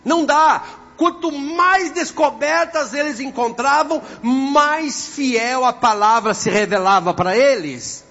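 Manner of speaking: loud, high, drawn-out proclamation; full-force preaching. Real, not acted. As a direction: preach it out to the whole congregation, stretching key words.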